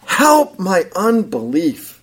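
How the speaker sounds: a father's cry, pushed out slowly. Retold or imitated. Father crying out to Jesus for help, slow pleading cry. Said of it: "Immediately he cries out".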